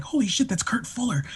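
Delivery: hushed, starstruck whisper; breathless fan whisper; excited whisper. Retold or imitated. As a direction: whisper it so he won't hear. Excited whisper on spotting actor.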